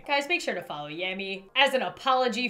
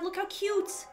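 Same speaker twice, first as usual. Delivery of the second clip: normal talking voice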